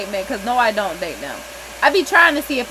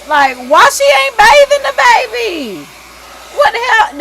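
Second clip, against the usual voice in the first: outraged; 11 semitones higher, 10 dB louder, slower than usual. Real, not acted. Outraged at unbathed baby.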